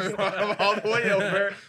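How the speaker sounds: high laughing repeat. Pretend. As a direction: laugh through the line. Repeats joke while laughing.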